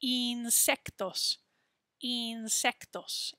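insectos slowed right down, ee-n-sec-tos; slow Spanish insectos. Shown as a demonstration. Teacher drawing out insectos slowly for students. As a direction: stretch insectos out, opening on ee. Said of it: "We're gonna say it like a E sound".

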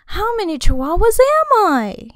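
high, loud, swooping question; exaggerated storybook wondering. A narrator's reading. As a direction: wonder aloud with a big soaring pitch. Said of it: "he wondered out loud".

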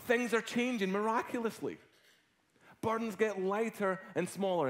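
loud, high preaching voice; preaching at full force. Acted. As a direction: preach it out to the congregation.

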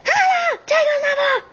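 snapping at an unwanted caller; angry shout. Acted. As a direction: bark it down the phone in a rage. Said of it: "proszę tak nie krzyczeć".

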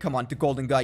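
normal talking voice

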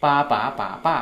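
ปา ป๋า ป๋า ป้า stepped through each tone; teacher chanting ปา ป๋า ป๋า ป้า tone by tone; tone demo. Shown as a demonstration. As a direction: model the tones of ปา ป๋า ป๋า ป้า for the class. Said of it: "ออกเสียงตัว C เนาะ".

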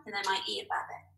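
ordinary talk

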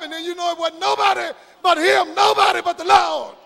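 emphatic preacher, drawing out the key phrases; full-voiced preaching. Real, not acted. Preacher urging the congregation to wait on God, emphatic preaching. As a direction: preach it out, building in force to the close.

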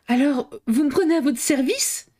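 high, hopeful cry; eager exclamation. A narrator's reading. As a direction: burst out with sudden hope. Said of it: "s'écria Gautret". (s'écrier plein d'espoir)

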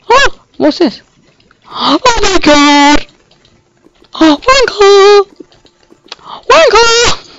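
high, drawn-out cries; slow high-pitched wail at the game; exclaiming. Real, not acted. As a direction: stretch each cry out, way up high. Calling out at a game mishap.

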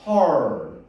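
her를 '허-ㄹ'로 길게; her, 모음을 낮게 늘여서. Shown as a demonstration. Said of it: "점 있으면 길게". (her의 r까지 길게 끌기)

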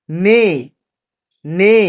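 "নে" with a long, stretched vowel; pronunciation demo. Shown as a demonstration. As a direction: model "নে" firmly for learners to repeat. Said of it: "আমরা আবার উচ্চারণগুলা করি".

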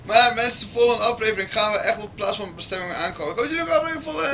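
youtuber outro. Acted. Outro to viewers.

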